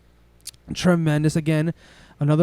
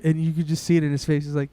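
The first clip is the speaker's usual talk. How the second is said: ordinary talk, nothing marked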